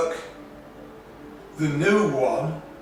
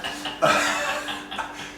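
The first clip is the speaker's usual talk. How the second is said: laughing. Real, not acted. Laughing at himself.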